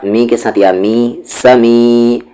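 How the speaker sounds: ordinary talk, nothing marked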